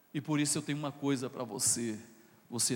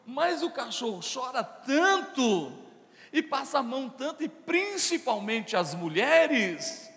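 loud, higher preaching build; preaching at full force; 10 semitones higher, 8 dB louder than usual. Acted. Pastor fires up the congregation, loud rising preaching. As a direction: drive the sermon upward with urgency.

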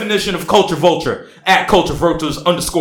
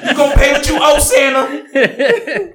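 mock shout; 10 semitones higher than usual. Dramatic mock call-out.

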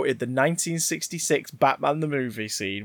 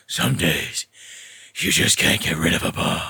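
much lower, growled Bale-style Batman; growly Batman voice; 12 semitones lower than usual. Retold or imitated. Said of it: "with his growly voice".

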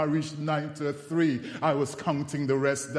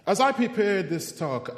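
ordinary talk

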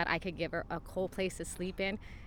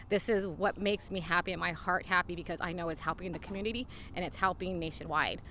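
normal talking voice

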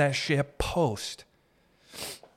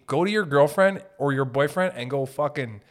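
ordinary talk

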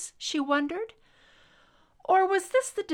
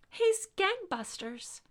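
weary mother; 11 semitones higher than usual. Acted. Weary mother excuses her son.

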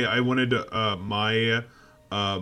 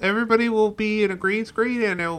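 higher, dopey George Lucas voice; George Lucas impression; 10 semitones higher than usual. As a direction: pitch the movie like a clueless Lucas. Impersonating George Lucas pitching.

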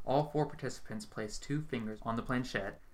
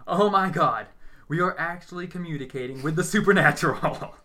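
loud, high gleeful exclaiming; thrilled outburst; 6 semitones higher, 11 dB louder than usual. A narrator's reading. Medium thrilled the spirit answers, loud and gleeful. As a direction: burst with wild delight. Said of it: "way too happy".

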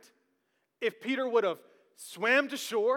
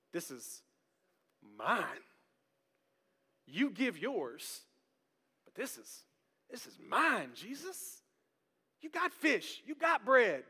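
softer, mock-possessive muttering; possessive entitled voice; 6 dB quieter, slower than usual. Voicing entitled selfish attitude.

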